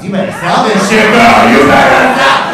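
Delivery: high, loud yelling at his own reflection; self pep shout. Retold or imitated. Pepping himself up in a mirror during a showing, yelling. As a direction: scream at yourself in the mirror to sell. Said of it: "I'll be like".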